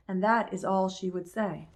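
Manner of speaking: ordinary delivery, nothing marked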